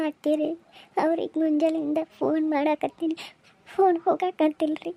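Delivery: tearful, breath catching between phrases; crying. Acted. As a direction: explain it through sobs. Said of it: "ಎಲ್ಲಾರು ಹಿಂಗ್ಯಾಕ್ ಅಳಾಕತ್ತೀರಿ?". (ಅಳುತ್ತಾ ಹೇಳುವುದು)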